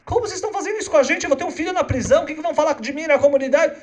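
very high, fast complaining parents; hammed-up parents pleading with the judge; upset parents. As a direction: fret like worried parents about the community. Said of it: "Vem os pais desse menino e falam".

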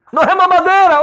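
put-on a kid insisting, wailing it; child protesting. Baby son protesting to his father, high loud whine.

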